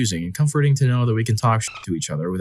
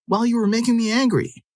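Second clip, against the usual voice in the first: mocking girlfriend; 13 semitones higher than usual. Girlfriend's excuse quoted.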